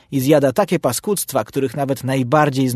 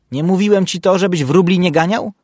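louder, higher scolding question; shouting; 6 semitones higher, 5 dB louder than usual. A narrator's reading. Puc scolds Bursztyn for chasing sparrows, shouting. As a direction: scold loudly at a raised pitch. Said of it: "krzyknął na niego Puc".

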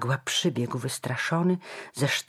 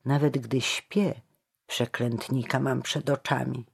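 ordinary delivery

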